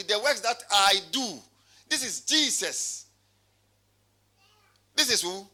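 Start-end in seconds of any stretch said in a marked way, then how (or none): 0.0-1.4 "I" drawn out and leaned on; slow down and hit "I do"; preacher pointing up the word "I"; stress on I. Shown as a demonstration. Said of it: "I'm emphasizing on who".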